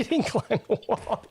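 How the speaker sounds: slow words broken by real laughter; chuckling as he talks; laughing. Real, not acted. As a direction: laugh through the quote. Laughs while quoting smokers.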